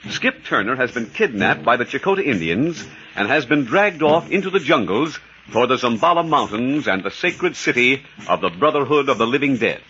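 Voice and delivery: ordinary talk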